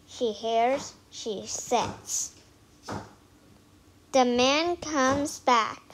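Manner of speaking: plain reading voice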